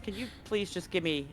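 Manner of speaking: ordinary talk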